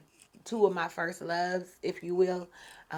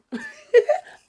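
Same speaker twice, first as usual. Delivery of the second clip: sudden squealed laughter; loud high laugh; 11 dB louder than usual. Real, not acted. Woman jokes about learning to be a player, bursts out laughing. Laughs after admitting inexperience.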